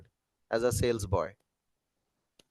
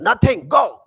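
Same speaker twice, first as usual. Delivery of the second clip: higher, louder snap to go away; irritated brush-off; 7 semitones higher, 7 dB louder, slower than usual. Retold or imitated. To the nagging colleague, an annoyed snap. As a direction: shoo her off in a temper.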